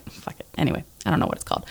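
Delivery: quick, whispered give-up; muttered aside. Real, not acted. Can't find the word, muttering a fast whispered aside. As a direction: mumble it away under your breath.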